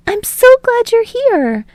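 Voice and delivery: put-on high, slow, beaming girl's voice; slow girlish warmth; glad and smiling. A narrator's reading. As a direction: let the gladness linger. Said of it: "smiling widely".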